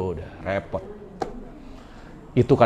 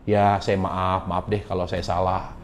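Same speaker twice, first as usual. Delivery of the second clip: softer, lower, meek apology; a gentle giving-in, barely raised; meek apologetic husband; 5 semitones lower, 7 dB quieter than usual. Retold or imitated. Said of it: "dia terimanya jadi".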